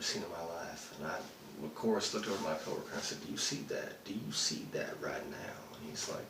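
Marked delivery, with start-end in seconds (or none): 3.2-5.6 urgent hushed question. Retold or imitated. Seeing flying saucer, asks coworker.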